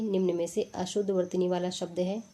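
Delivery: ordinary talk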